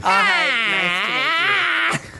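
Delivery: shrieking high greeting. Acted. Cashier greets platypus.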